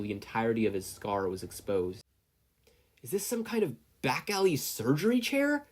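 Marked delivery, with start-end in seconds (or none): 3.0-5.7 panicked thought. A narrator's reading. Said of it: "Yagi thought as he struggled".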